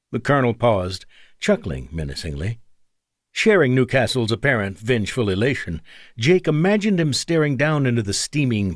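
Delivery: ordinary delivery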